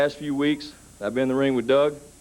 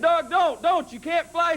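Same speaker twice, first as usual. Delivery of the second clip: pleading; slower than usual. Retold or imitated. Pleading with brother on roof.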